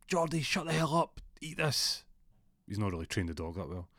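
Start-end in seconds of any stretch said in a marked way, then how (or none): 0.1-2.0 higher, clearly voiced scolding of the dog. Jack scolds the barking dog in the woods, higher voice. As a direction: snap at the dog and shove a treat at it. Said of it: "and he goes".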